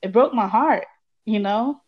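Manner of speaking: ordinary talk, nothing marked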